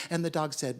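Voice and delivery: plain reading voice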